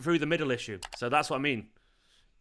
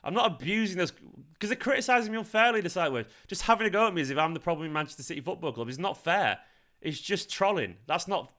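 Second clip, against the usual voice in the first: higher-pitched, exasperated complaint; real wounded indignation; aggrieved protest; 5 semitones higher than usual. Real, not acted. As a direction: plead your case, indignant at unfair blame. Aggrieved streamer protesting at trolls.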